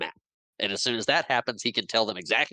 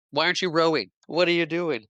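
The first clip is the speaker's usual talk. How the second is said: higher-pitched mock indignant questioning; retold demand, pitched up; indignant challenge; 8 semitones higher, 3 dB louder than usual. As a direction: act out calling someone out. Retold challenge to a non-rower.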